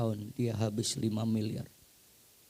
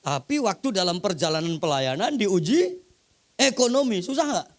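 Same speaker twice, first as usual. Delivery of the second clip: much higher and louder, a hammering pulpit cry; full-force preaching; 9 semitones higher, 10 dB louder than usual. Acted. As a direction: preach it out to the whole hall. Preacher building again.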